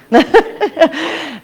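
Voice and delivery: a short high laugh at her own joke. Real, not acted. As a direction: laugh lightly, then move on.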